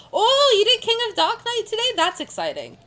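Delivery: high, loud, delighted "oh"; excited interest. Real, not acted. Streamer hearing what the raider played today, excited surprise.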